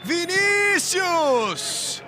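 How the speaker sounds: long drawn-out goal yell; goal shout. Real, not acted. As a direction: stretch the goal cry out. Goal call for Vasco. (grito de gol longo)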